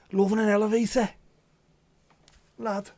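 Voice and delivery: high, loud Scouse rock-singer impression. Co-host mocking a rock star as a Scouser, exaggerated Scouse impression. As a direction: belt it out in a high Scouse accent. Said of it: "[name]'s doing a Scouse impression".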